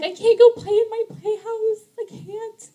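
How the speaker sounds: put-on high little-girl whine that fades to a tearful whimper; whiny little girl. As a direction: play a five-year-old close to tears. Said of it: "our five-year-old daughter was like".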